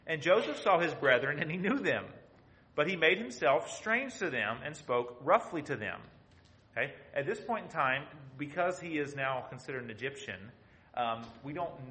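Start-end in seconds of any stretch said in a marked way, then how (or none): 0.0-6.0 loud, declaiming Bible reading; proclaiming King James verses to the congregation; scripture reading. Acted. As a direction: read the verses out like a preacher. Reads scripture aloud.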